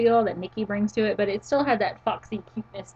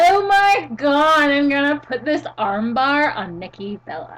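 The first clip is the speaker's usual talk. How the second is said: put-on sorority girl; 4 semitones higher, 5 dB louder than usual. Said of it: "That is the word sorority".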